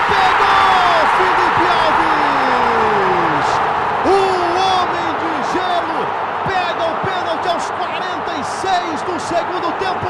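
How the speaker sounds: long drawn-out shout of the save; penalty save shout. Real, not acted. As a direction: hold the shout out, then keep calling loud. Goalkeeper saves late penalty.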